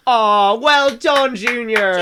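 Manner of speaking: high, slow mock praise. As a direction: coo it like praising a child. Mock praise after rhyme.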